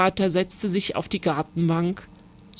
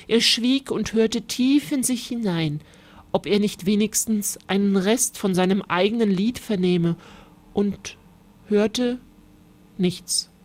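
ordinary delivery, nothing marked

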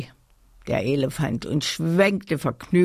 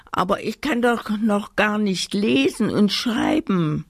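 high-pitched doll complaint; complaining doll; 6 semitones higher than usual. A narrator's reading. Doll Amanda protesting she can't read yet, high plaintive voice. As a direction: wail lightly in a high doll voice. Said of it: "klagte die Puppe Amanda".

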